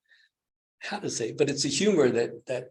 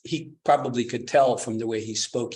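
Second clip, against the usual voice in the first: ordinary talk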